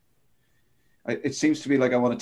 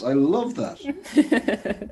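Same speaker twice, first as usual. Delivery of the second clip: delighted; 8 semitones higher, much slower than usual. Real, not acted. Delighted by the funny name.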